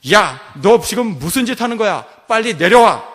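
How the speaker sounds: high, loud, urgent yell; shouted rebuke. Retold or imitated. Booth yelling at the man on the ladder, shouted rebuke. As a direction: roar at him to get down. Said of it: "윌리엄 부스는 소리쳤습니다".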